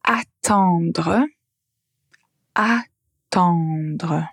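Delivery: "Attendre" said twice with a clear open "a" in the first syllable; teacher modelling the "a" sound of "attendre"; pronunciation demo. Shown as a demonstration. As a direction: stress the open "a" at the start of "attendre". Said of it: "la première syllabe est différente".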